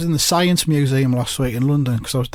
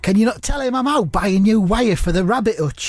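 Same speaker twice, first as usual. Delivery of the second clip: raised-pitch comic impression; Mark Lawrenson; 6 semitones higher than usual. Comedian as Mark dodging a phone call, in high impression. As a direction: do the character in a put-on higher voice. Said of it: "I'll just say them as Mark".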